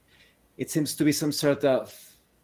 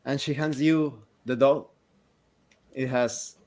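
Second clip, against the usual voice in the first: usual speaking voice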